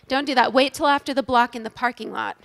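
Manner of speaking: ordinary delivery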